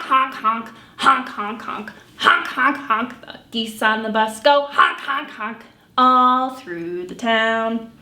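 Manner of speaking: normal talking voice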